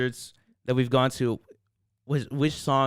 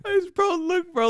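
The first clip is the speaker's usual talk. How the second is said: very high teasing squeak; high squeaky voice. Pretend. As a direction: squeak it to tease your friend. Mocking friend's speech.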